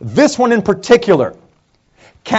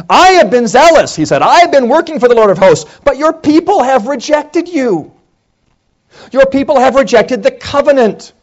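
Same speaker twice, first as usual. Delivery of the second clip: Elijah pleading; 7 semitones higher than usual. Acted. Elijah's complaint voiced.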